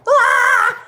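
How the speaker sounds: high, loud shriek of alarm; startled shriek. Real, not acted. Instructor startled by her camera falling, shrieking. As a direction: yelp as the setup goes wrong. Camera mishap mid class.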